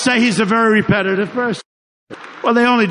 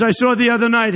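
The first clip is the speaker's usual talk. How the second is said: ordinary talk, nothing marked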